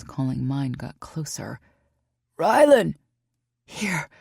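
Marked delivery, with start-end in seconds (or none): 2.4-3.0 loud call across the woods; distant searcher calling Rylan. Acted. Searchers calling her name. 3.6-4.1 weak croak. Acted. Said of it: "Fuck, that was weak".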